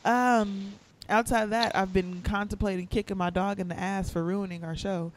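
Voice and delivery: distant, off-mic voice; speaking far away from the microphone. Real, not acted. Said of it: "why do you sound so far away?".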